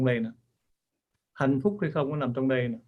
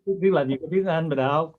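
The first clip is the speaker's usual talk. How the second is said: ordinary talk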